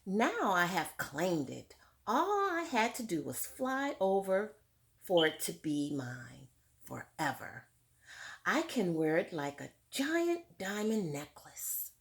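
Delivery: clear, fuller read-aloud voice to the class; story narration. A narrator's reading. Teacher reading Tar Beach to her class, lively read-aloud. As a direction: read it out like a picture book for children. Reads book text aloud.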